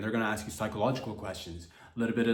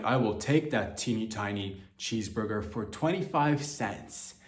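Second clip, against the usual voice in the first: mock hard bargainer; 5 semitones higher than usual. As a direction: slam down a lowball offer. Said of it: "be like".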